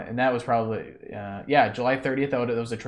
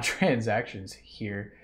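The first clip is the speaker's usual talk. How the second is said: ordinary talk, nothing marked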